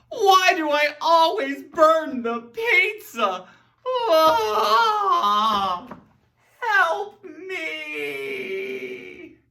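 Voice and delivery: slow loud wailing lament; mock despair. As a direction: wail over the ruined pizza. Acted burnt pizza despair.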